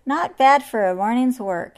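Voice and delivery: proud anteater voice. Acted. Anteater proud of his work.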